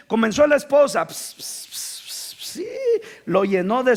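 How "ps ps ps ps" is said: unvoiced spraying hiss, ps ps. Preacher imitating the wife spraying the pastor's hair. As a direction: mimic a spray can hissing through the lips.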